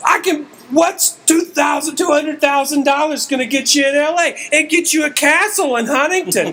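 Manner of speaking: high-pitched ranting comic impression; Bill Burr. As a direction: rant like an exasperated stand-up comic. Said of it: "That is perfect Bill Burr".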